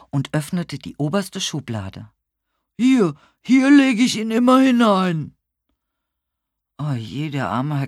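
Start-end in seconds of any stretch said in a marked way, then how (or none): 2.8-5.4 high, loud lament; frantic old neighbour, voice raised; distraught old man. A narrator's reading. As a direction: wail it at the empty drawer. Robbed old man shows empty drawer.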